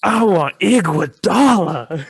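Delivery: loud, slow, high bellow; mock-dramatic shout. Topping the co-host's pick, mock-dramatic shout. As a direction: bellow the name, drawn out and hammed-up.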